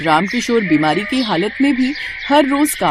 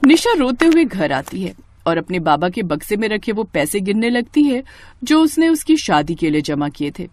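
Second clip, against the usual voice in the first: ordinary delivery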